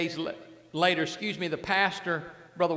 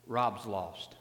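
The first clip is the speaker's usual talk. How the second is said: inner voice of God; 8 semitones lower, 6 dB quieter than usual. Retold or imitated. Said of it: "It was like the Spirit of God saying".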